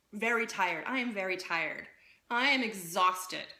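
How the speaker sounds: firm, deliberate falling statements; with conviction. Shown as a demonstration. Coach showing clients language that has conviction, said firmly. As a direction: land each phrase with full certainty. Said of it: "is with conviction".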